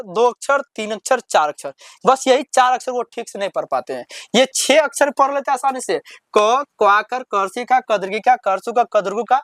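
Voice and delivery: normal talking voice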